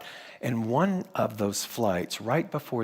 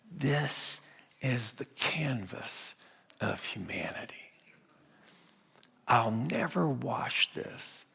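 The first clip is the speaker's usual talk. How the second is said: hushed reverence; 6 dB quieter than usual. Retold or imitated. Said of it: "And she said".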